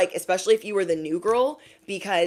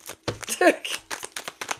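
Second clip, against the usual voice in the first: breathy laugh; 11 semitones higher than usual. Real, not acted. Laugh alone.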